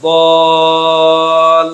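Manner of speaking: বল stretched into a long sung vowel; long drawn vowel. Shown as a demonstration. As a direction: hold the vowel of বল as a long chant. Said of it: "এভাবে পড়তে হবে".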